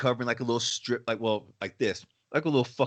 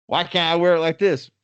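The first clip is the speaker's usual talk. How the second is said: mock protest, higher and louder; imitated indignant customer; 5 semitones higher, 6 dB louder than usual. Customer told to put the mask on, imitated protest. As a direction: push back like an affronted customer.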